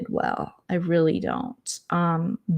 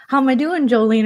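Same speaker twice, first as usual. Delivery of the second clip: mock Amber; 6 semitones higher, 6 dB louder, faster than usual. Quoting Amber asking her.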